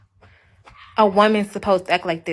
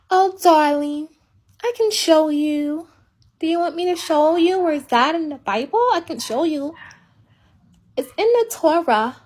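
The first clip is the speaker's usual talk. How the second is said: meek, gentle wife; 5 semitones higher than usual. Acted. Wife offering to show her husband the Bible, mock meek and gentle. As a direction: play the over-patient, obliging wife. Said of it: "Be all meek and gentle".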